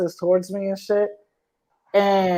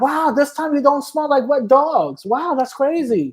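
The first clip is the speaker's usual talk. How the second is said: mock; 6 semitones higher than usual.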